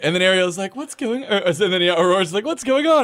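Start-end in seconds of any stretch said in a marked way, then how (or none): 0.8-1.4 high, innocent mock princess voice; waking princess. Princess waking after the final battle, high and bewildered. 2.4-3.0 very high, clueless mock princess; waking princess. Aurora waking after the final battle, high and bewildered.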